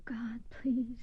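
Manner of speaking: breathless whisper to God; whispered prayer. Acted. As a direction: pray in a frightened whisper. Frightened prayer under her breath.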